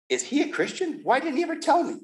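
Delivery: can't believe nobody told him; astonished. Retold or imitated. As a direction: pitch leaps high, disbelieving.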